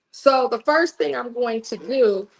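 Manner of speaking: usual speaking voice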